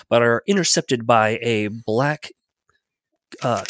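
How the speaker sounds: usual speaking voice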